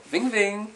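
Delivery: high, buzzy mimicked phone ring; phone ring imitation. Vampire imitating his phone ringing, high ving ving. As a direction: sing the ring of an old phone. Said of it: "this is the phone".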